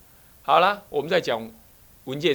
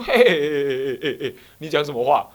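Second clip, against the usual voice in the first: laughing; 7 dB louder than usual. Retold or imitated. Laughing rebuke of monk's words.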